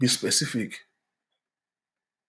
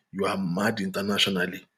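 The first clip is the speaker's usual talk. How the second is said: whisper; 7 semitones lower, 6 dB quieter than usual. Real, not acted. Aside to the audience.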